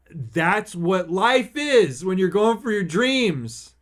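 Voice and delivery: loud, high motivational speech; impassioned. Real, not acted. Podcaster urging listeners to go for their dreams, impassioned. Emotional motivational climax of the story.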